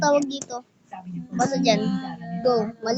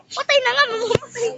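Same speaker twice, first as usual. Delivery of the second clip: much higher, startled outburst; surprised; 10 semitones higher than usual. Real, not acted. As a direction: squeak it in disbelief. Surprised reaction high pitch. (gulat na gulat, mataas ang boses)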